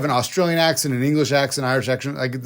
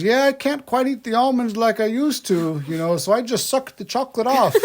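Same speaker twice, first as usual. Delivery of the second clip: put-on high, sweet old lady voice, pitched well up; 10 semitones higher than usual. A cleaner's story, the old woman offers almonds in a high old lady voice. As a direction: chirp it like a frail grandma. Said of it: "she goes".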